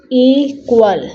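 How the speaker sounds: "equal" broken into e, pause, qual; split into syllables. Shown as a demonstration. Teacher showing pupils each sound of a word, split into syllables. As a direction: say "equal" in two separate beats. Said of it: "so E one sound है".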